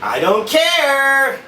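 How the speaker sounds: loud, slow, high yell back; wife yelling back through the door; defiant shout. Retold or imitated. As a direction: shout each word defiantly. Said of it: "wife yelling back through door".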